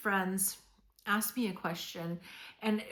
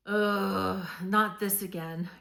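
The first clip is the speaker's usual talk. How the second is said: loud, drawn-out ugh of exasperation; exasperated groan; 7 dB louder, slower than usual. Acted. Voicing someone hit by grief resurfacing, exasperated. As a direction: groan it out, fed up. Acting out weariness at returning grief.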